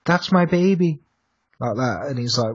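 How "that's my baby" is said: lightly voiced ghostly voice, pitched up; retold mystery voice, raised a little; eerie voice imitation. Storyteller quoting a voice from the kitchen, lightly acted. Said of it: "He hears a voice in the".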